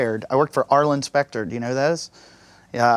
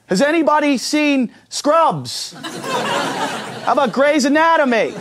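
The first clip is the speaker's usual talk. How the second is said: much higher, slow, laughing mock plea; hammed-up attendant asking for TV doctors; desperate flight attendant; 11 semitones higher, slower than usual. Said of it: "what were they really gonna say next".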